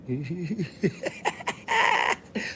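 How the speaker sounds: high, rising cackle; real laugh. Real, not acted. Laughing after saying he's here for money, high cackle. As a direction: break into a long high laugh.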